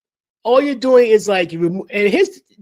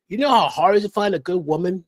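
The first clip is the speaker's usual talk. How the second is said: ordinary talk, nothing marked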